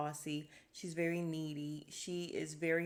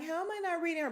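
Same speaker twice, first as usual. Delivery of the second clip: much higher mock-whiny voice; mocking Sonya; 11 semitones higher, much faster than usual. Host mimicking Sonya's question again, high mock whine. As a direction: repeat it like a clueless complainer. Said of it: "And she's like".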